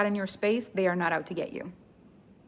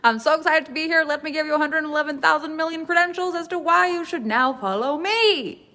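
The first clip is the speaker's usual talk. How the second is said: higher, gushing self-promotion, a touch hammed-up; mock sneaky expert; 6 semitones higher than usual. Coach mocks a self-promoting expert, lightly gushing. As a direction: parody a braggy newcomer. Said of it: "who's like".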